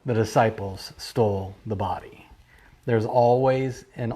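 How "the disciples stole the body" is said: lower, soft hissing whisper; serpent's whisper. Shown as a demonstration. Pastor voices the serpent's lie to his listeners, whispered.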